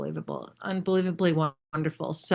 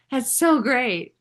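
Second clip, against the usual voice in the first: laughing, higher and louder, drawn out; laughing delight; 6 semitones higher, 4 dB louder, slower than usual. Real, not acted.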